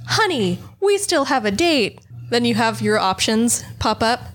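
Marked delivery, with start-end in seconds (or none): 0.1-2.0 angry wife. Retold or imitated. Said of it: "angry wife enters the room".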